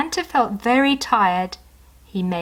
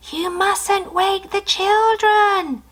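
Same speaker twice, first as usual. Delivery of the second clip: much higher, slow hushed warning; snowman voice; 12 semitones higher, slower than usual. Acted. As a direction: remind him sweetly. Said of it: "whispered the snowman".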